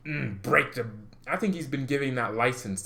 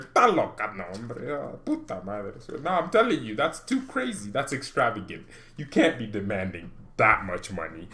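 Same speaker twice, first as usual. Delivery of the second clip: ordinary talk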